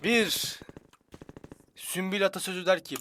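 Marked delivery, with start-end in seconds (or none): none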